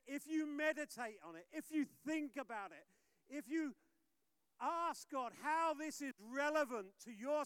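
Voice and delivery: loud, high rousing sermon call; preaching at full force. Acted. Pastor exhorting his congregation, loud preaching.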